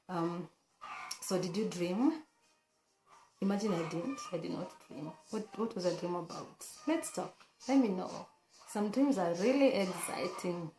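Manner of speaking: ordinary talk, nothing marked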